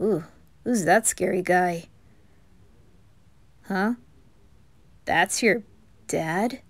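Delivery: ordinary talk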